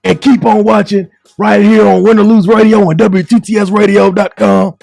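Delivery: host playing a visiting bishop; Bishop D H Jakes. Host introducing himself as a bishop, preaching cadence. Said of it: "This is Bishop D H Jakes".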